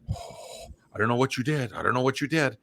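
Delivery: hushed mock dread after a sigh; receptionist in on it. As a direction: play the receptionist quietly warning of trouble. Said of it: "his receptionist was in on this because she said".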